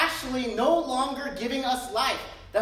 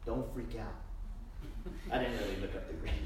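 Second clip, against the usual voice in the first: much softer and lower, tossed off; quiet aside; 13 semitones lower, 10 dB quieter, much faster than usual. Shown as a demonstration. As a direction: drop it to a soft aside. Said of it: "the Greek here is translated".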